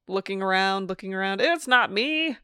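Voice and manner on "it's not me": slow, higher mock old-timey newscaster voice. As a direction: stretch it out like an old radio newscaster. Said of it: "old-timey newscaster voice".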